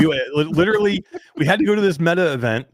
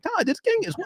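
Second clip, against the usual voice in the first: much higher, incredulous squeak; mock disbelief. Host retelling his reaction to Todd, in high mock disbelief. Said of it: "I'm like".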